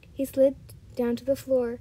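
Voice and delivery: ordinary delivery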